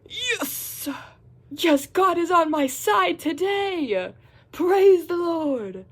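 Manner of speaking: high, loud, slow triumphant cry; ecstatic cheer. Retold or imitated. Hearing the trip is off, cheering loudly in relief. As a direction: celebrate like a revival shout.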